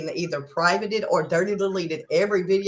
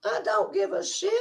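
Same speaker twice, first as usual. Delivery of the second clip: defiant, pitch leaping up; angry outburst; 4 semitones higher than usual. Real, not acted. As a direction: fire it back, furious. Heated argument with co-host.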